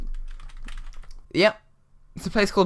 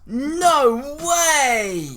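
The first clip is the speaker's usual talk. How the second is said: mock astonishment; 8 semitones higher than usual. Mock shock at twist.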